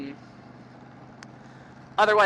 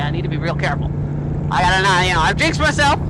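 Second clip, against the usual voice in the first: ordinary talk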